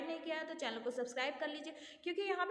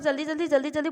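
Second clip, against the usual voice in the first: loud, quick run of repeated words; rapid-fire repetition; 14 dB louder, much faster than usual. Shown as a demonstration. Teacher showing students how someone talks too fast, rapid-fire. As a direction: rattle it off to mimic a fast talker. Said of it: "एकदम टू x की speed में".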